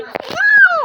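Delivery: high loud sudden shout. Real, not acted. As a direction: yell it in alarm. Reacts in game. (biglang sigaw)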